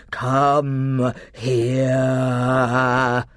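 hugely drawn-out roaring command; almost a roar. A narrator's reading. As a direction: stretch the last word into a lion's roar. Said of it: "no longer the hint of a roar, but almost the real thing".

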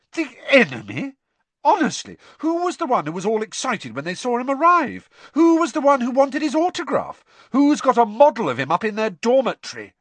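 high, loud, incredulous tirade; indignant retort. Acted. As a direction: fire accusing questions back. Hermione quarrels back at Ron.

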